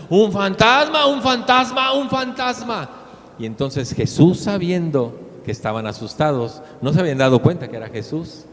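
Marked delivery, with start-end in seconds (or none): 0.1-2.9 put-on much higher, louder frightened cry, screamed like little girls; scared shriek. Disciples see a figure in the dark, shriek in mock fright. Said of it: "empezaron a gritar como niñas".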